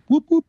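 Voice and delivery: high whooping siren noise; police siren imitation. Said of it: "it sounds like a police siren".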